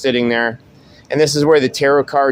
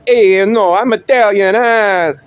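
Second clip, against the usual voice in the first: hammed-up Italian accent, higher and louder; 6 semitones higher, 4 dB louder than usual. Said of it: "'Cause they all talk like that".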